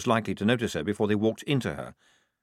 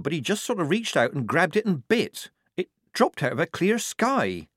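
higher, animated soldier's report; young soldier character; 8 semitones higher than usual. Acted. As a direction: report it to the squad, baffled and high-pitched.

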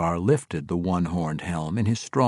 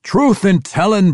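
loud, high retort; defiant dwarf snap; spat angrily; 12 semitones higher, 10 dB louder than usual. A narrator's reading. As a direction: spit it back at them. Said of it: "Bruenor spat at both of them".

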